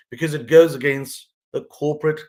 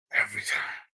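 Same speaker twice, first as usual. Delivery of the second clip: hushed, low self-reproach; rueful near-whisper; 4 semitones lower, 8 dB quieter than usual. Retold or imitated. Man scolding himself after repeating a mistake, hushed. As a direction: whisper it to yourself in mock regret. Retelling his regret after drinking.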